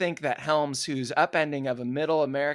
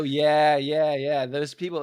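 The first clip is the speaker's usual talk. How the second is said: ordinary delivery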